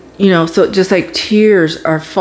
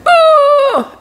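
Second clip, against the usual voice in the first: pained cry; 3 dB louder than usual. Retold or imitated. Retold cry of pain.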